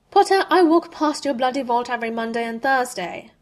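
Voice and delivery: loud, sharp scornful reply; snapping. A narrator's reading. Malfoy snapping at Harry about his vault, loud and sharp. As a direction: cut him down with scorn. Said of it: "Malfoy snapped".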